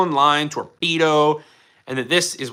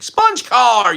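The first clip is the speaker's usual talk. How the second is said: loud, high triumphant reveal; excited reveal; 8 semitones higher, 6 dB louder than usual. Real, not acted. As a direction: announce it like a showman. Announcing the prop.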